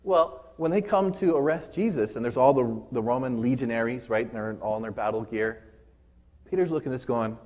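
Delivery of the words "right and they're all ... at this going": ordinary talk, nothing marked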